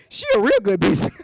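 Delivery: loud, high, quick country twang; hammed-up country talk breaking toward a laugh. Laughing at own joke, hammed-up country talk. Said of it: "that's that country talk for y'all".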